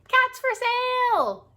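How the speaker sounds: high, slow announcing of the sign; dramatic sign reading. Acted. Reading the shop sign to listeners, high and slow. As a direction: read the sign like a big announcement.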